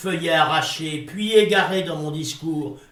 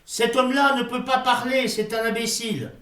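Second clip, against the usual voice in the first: mocking the crowd; 7 semitones higher than usual. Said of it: "Il dit".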